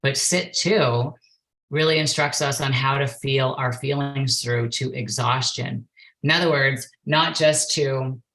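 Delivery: ordinary talk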